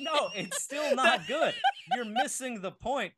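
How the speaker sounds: high laughing protest. Real, not acted. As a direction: protest while cracking up.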